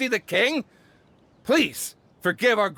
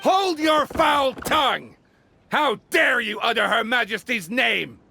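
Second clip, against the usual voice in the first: outraged rebuke. Acted. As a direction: scold them in outrage, stretching each word at first. Outraged herald rebukes the visitors.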